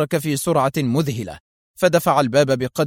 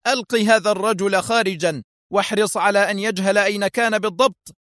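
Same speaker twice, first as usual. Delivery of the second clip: higher, tense order; irritable; 7 semitones higher than usual. A narrator's reading. Foster turns to Danny to order, irritably. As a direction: give the order tensely at raised pitch. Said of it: "متابعا في عصبية".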